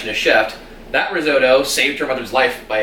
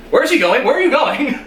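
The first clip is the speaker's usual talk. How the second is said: climbing higher, amused incredulous squeak; laughing mock disbelief; 11 semitones higher, 3 dB louder than usual. Host retelling Lorelai watching the headmaster leave, amused disbelief. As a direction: toss it off high and baffled, half laughing. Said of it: "Lorelai's like".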